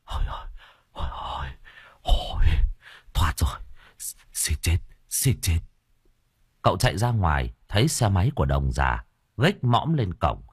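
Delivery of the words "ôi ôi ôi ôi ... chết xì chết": panting relief. A narrator's reading. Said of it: "Bây giờ cậu mới thở phì phì".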